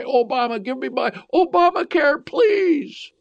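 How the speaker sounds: high, whiny pleading; mock begging. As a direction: beg like a spoiled child, pitch climbing. People begging Obama.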